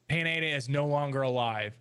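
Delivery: ordinary talk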